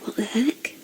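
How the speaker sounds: whispering. Real, not acted. Puzzled, searching for a comment.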